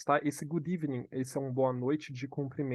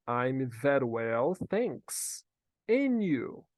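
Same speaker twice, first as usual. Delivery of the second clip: teacher modelling; 3 dB louder, much slower than usual. Shown as a demonstration. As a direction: model it slowly for learners to repeat. Teacher models answer for repetition. (devagar, em partes, para repetir)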